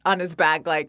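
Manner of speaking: usual speaking voice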